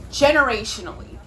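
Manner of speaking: ordinary talk, nothing marked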